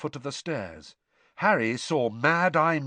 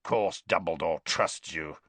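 low growling sneer; growled; 6 semitones lower than usual. A narrator's reading. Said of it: "growled Moody".

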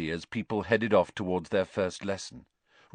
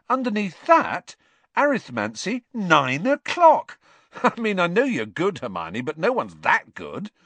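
high, loud, amused disbelief; can't believe what he reads; incredulous; 9 semitones higher, 4 dB louder than usual. A narrator's reading. Ron to Hermione about her timetable, amused disbelief.

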